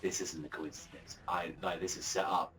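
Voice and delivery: low, soft scheming voice; sinister low villain. Retold or imitated. Azumi realizing the setup, low and sinister. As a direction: murmur like a plotting villain. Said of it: "and he's like".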